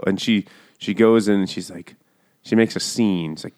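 ordinary talk, nothing marked

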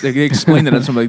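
ordinary talk, nothing marked

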